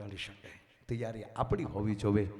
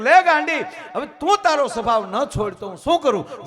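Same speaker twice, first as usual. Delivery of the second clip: high, shouted reproach with stress on તું; the guru yelling in exasperation, hitting તું; laughing, shouted rebuke, much louder and higher; loud scolding; 13 semitones higher, 19 dB louder than usual. Retold or imitated. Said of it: "સદગુરુએ કીધું કે".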